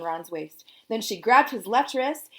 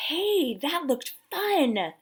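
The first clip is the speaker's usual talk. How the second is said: put-on slow, higher eager girl; a hint of a keen kid; lightly voiced girl; 7 semitones higher, much slower than usual. A narrator's reading.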